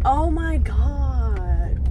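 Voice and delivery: drawn-out, loud disbelief; stunned oh my God. Real, not acted. Vlogger finds the lot full at six, slow loud disbelief. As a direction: stretch it out in disbelief.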